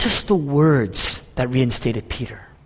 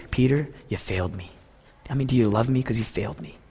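gentle Jesus; 4 semitones lower, 7 dB quieter than usual. Acted. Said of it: "what he's saying is".

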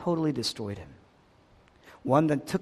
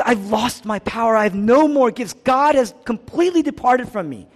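high, loud outcry, building in force; anguished lament; 6 semitones higher, 7 dB louder than usual. Acted. Preacher voices Jesus as God departs from him, anguished outcry. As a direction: proclaim the abandonment with rising intensity.

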